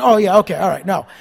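usual speaking voice

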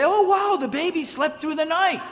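oblivious morning delight, pitched high; chirpy, high-pitched amazed husband; delighted wonder. Retold or imitated. Husband waking up after the baby's night, mock-delighted. Retold naive morning remark to wife.

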